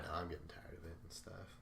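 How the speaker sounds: soft whisper under the breath; whispered aside. Real, not acted. Host muttering he's tired of redemption stories, whispered. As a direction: drop to a whisper like a confession.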